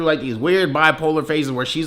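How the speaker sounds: ordinary talk, nothing marked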